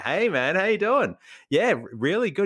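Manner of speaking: high, upbeat matey reply; match his friendly energy; playing along. Tired shopper plays along with the teen, upbeat. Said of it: "I'm like".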